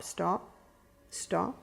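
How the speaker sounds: put-on low, soft, steady command to a crying child; calmly but firmly say stop. Shown as a demonstration. Grandmother showing how to hush a crying toddler, soft and firm. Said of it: "always get them to stop".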